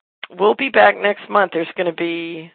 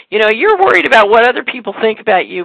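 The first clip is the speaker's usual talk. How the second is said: retold pastor's angry sermon, louder and higher; angry preacher; 5 semitones higher, 7 dB louder than usual. Pastor scolding churchgoers from the pulpit, mock angry. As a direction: voice the worked-up pastor scolding the congregation. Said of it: "he was very angry".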